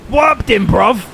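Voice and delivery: high, loud hammed-up London slang; mock London accent. Mocking British viewers, loud mock London accent. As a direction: bark it like a cartoon roadman.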